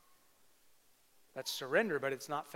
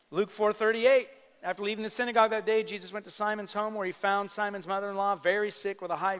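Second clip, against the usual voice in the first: loud, high preaching read; 6 semitones higher, 9 dB louder than usual. Acted. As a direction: proclaim the scripture to the room. Preacher reads scripture at full force.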